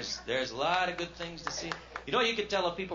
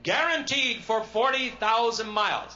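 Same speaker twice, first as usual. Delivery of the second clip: booming ad pitch; 8 semitones higher, 6 dB louder, slower than usual. Retold or imitated. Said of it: "tire guarantee slogan".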